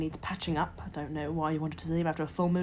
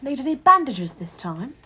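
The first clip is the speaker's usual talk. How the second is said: brisk matron voice; 8 semitones higher, 10 dB louder than usual. Acted.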